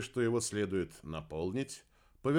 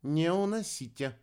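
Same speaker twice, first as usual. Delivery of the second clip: higher, commanding woman's voice; giving orders; 8 semitones higher, 4 dB louder than usual. A narrator's reading. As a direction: countermand it with a raised, firm voice. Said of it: "распорядилась рыжая". (повелительно, высоким голосом)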